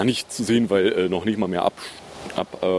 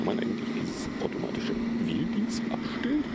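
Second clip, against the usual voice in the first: hushed whisper; 7 semitones higher, 8 dB quieter than usual. Real, not acted. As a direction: whisper the question under breath. Quietly asks mid-recording.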